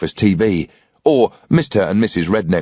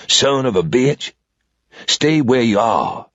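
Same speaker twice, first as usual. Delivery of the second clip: put-on old southern man; 4 semitones higher, slower than usual. A narrator's reading. Old man holding a revolver on the intruder, slow drawl. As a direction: drawl it out, cool and unhurried.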